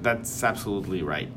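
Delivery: ordinary delivery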